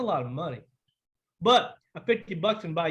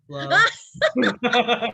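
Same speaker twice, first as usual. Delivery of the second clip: laughing hello, louder; 3 semitones higher, 6 dB louder than usual. Real, not acted. Laughing greeting.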